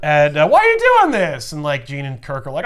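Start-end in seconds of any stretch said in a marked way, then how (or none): 0.5-1.5 high, loud hammed-up plea; mock anguish. Villain pleading with his attackers, mock anguished cry. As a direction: wail the question in protest.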